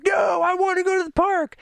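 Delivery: mock tantrum of a small son; child's demand. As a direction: yell it like a stubborn little boy. Son insists on the park.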